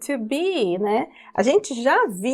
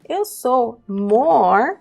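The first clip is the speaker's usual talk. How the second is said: slow teaching; much slower than usual. Shown as a demonstration. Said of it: "teacher drawing out the word".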